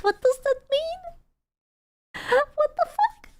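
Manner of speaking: squeaky, very high, slow and stretched-out disbelief; a cartoonish high voice of confusion; mock baffled squeak. Streamer to chat, puzzled by a weird remark, squeaky mock disbelief.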